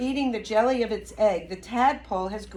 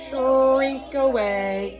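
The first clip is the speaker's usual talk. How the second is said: stretched, slightly louder; slow drawn-out; 3 semitones higher, 4 dB louder, much slower than usual. Shown as a demonstration. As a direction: draw each word out.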